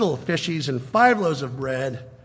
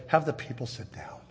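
softer, unhurried and steady; calm command; 3 semitones lower, 11 dB quieter than usual. Retold or imitated. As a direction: settle the crowd with quiet authority. Jesus' command, voiced by preacher.

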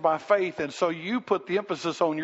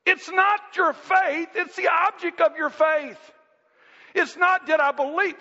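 impassioned preaching; 9 semitones higher than usual. Acted. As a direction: preach it hard. Preaching at full force.

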